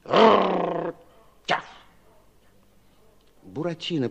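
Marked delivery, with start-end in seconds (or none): 0.0-1.6 growling then a sharp yap; dog growl and yap. A narrator's reading. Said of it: "Сыщики ответили".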